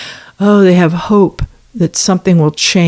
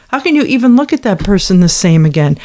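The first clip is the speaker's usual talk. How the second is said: ordinary talk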